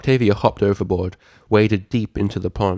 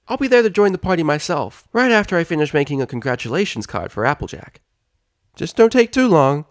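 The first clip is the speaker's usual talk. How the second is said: light character voice; 8 semitones higher than usual. Acted. Spike and Twilight voiced.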